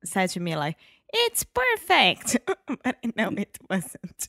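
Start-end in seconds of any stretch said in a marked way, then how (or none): 1.1-2.4 high, slow, fond imitation of dad saying It's perfect; imitating dad's praise. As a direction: do dad's gentle praise, much higher and slower. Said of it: "your dad one day said to me".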